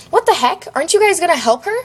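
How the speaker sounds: high, urgent cry; scream. A narrator's reading. Narrator to bystanders outside the burning house, screaming. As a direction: shout it high, quickening into an accusing question. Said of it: "I screamed".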